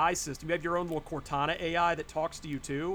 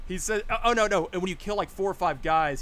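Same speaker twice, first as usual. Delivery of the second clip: ordinary talk, nothing marked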